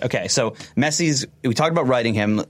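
usual speaking voice

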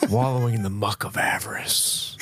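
whispered wrestling-promo menace; Jake the Snake. Retold or imitated. Podcaster finishing the looked-up promo for a co-host, in a whispered wrestler voice. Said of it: "I wanna quote one of his".

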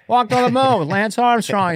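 high Biden yell. Retold or imitated. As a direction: shout it like old Joe. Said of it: "You know how he yells".

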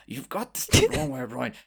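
hushed, whispery creature voice, rising at the end; Salazar. Acted. Tree mollusk answering the sister's accusation, hushed then rising. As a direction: start in a whisper, climb to a threat. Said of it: "like, says".

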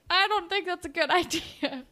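high, giggling Peter impression; breaking into laughter mid-quote; laughing through quote. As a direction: quote him while cracking up.

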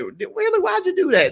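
very high, squeaky mock-amazed question; mock surprise. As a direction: act stunned in a high squeak.

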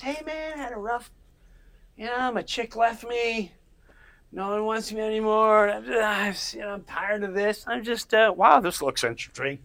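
high, moping chimp voice that perks up at the end; be a heartbroken chimp who spots a frog; voicing a sad chimpanzee. Voicing the chimpanzee in the video, heartbroken then curious. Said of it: "he's just".

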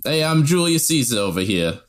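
comic New Jersey Italian accent; Italian-American Jersey accent. Shown as a demonstration. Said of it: "like, Jersey accents".

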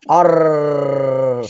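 "Arr" with a loud, very long trilled R; mock Scottish rolled "Arr"; excessively rolled R. Shown as a demonstration. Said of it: "roll your R's excessively long".

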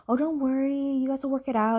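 high, singsong retold comforting; mock reassurance. Man comforting his upset friend, mock-reassuring. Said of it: "And he tells her".

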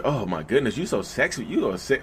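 a hushed mock leer, coming on to someone crying; creepy abuser voice.

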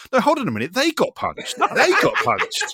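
squeaky dawning outrage; mock realisation. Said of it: "And then you go".

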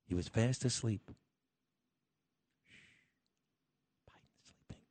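hushed whisper, don't wake him; whispering over a crib. Pretend. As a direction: whisper like a baby sleeps nearby. Host hushes, Biden asleep.

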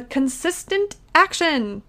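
"consistent" stressed high and drawn out before "action"; emphasis on CONSISTENT. Real, not acted. Coach naming step two to listeners, emphasis on "consistent". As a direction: lean hard on the word "consistent". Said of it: "emphasis on the".

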